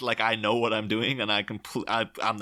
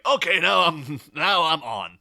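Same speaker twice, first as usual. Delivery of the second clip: raised, loud showman's declaration; performer switching on; 5 semitones higher, 5 dB louder, slower than usual. Retold or imitated. Actor taking command of the room, loud and showy. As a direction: announce it big and high. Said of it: "It's a guy really just like".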